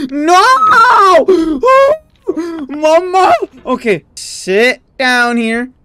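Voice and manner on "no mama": high, loud panicked wail; real panic; panicked cry. Real, not acted. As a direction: cry out as you fall. Panic falling again.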